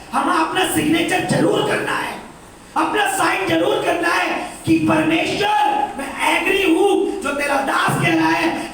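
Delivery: high, loud preaching cry; preaching at full force. Acted. Pastor urges the congregation, preaching loud. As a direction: preach it out over the hall.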